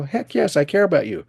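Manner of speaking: high, eager burst of affirmation; retold enthusiastic reassurance; emphatic heck yes. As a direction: insist you care, pitch jumping up. Emphatic reply to student.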